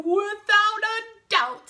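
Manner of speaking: high, slow, loud agreement; grandly overdone bear voice; bear character. Acted. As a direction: drawl it emphatically as the bear. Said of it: "said the bear".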